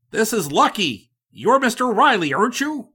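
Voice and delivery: high, loud, eager greeting; eager stranger. A narrator's reading. Stranger hailing Riley in the snowstorm, high and eager. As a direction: hail him like a lucky find.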